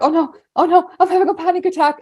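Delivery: high, hammed-up panicky wail; imitating a frightened kid; mock panic. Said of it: "he was like".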